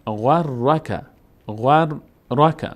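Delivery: غر and رک drawn apart slowly; slow syllable demo. Shown as a demonstration. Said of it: "یک بار با فتحهٔ خودش". («غر، رک» آهسته و هجا به هجا)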